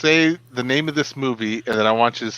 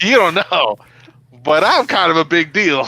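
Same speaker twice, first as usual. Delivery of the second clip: louder, higher playful brag; mock boasting; 4 semitones higher, 5 dB louder than usual. Bragging to co-host about the eggplant parmesan, mock boastful. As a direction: puff it up as a joke. Jokingly boasts after cooking success.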